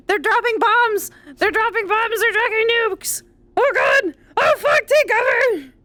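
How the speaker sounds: high, panicked alarm. Real, not acted. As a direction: sound the alarm as the bombs fall. Panicking as bombs drop in game.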